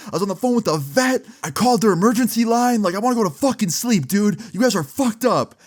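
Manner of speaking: retold rant, voice pushed way up and strained; furious high yell. Patrick yelling at his pranking friends, furious high rant. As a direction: scream it shrill and fed up at your friends.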